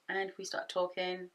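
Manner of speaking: usual speaking voice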